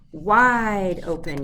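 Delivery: loud slow order; yelled. A narrator's reading. Dentist's wife yelling at the fox to open wide. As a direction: call it out long and strong. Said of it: "yelled his wife".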